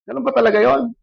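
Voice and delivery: a touch of crying on the phone; retold crying. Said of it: "Iyak ako sa phone".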